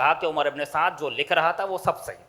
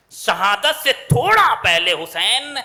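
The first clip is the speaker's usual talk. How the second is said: solemn climax; 6 dB louder, slower than usual. Acted. Climax, turn to lament.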